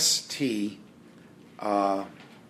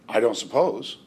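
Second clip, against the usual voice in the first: ordinary delivery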